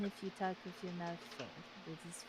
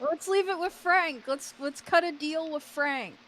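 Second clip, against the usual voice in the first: excited; 9 semitones higher, 11 dB louder than usual. Real, not acted. Excited chant of plan.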